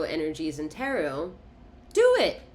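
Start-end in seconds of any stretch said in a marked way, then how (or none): 1.9-2.4 sudden shrill urging; urgent command. Acted. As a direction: do it squeaked high and loud. Urging the viewer to isolate.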